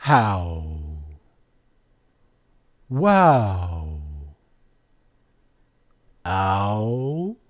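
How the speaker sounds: How, Wow, Ow each stretched out; teacher's drawn-out How, Wow, Ow; demonstrated "ow" sound. Shown as a demonstration. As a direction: hold the "ow" glide in How, Wow and Ow. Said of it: "that sound".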